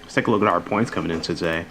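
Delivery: talking around ice in the mouth. Real, not acted. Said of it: "I can't talk right".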